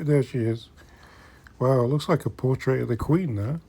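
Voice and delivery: ordinary delivery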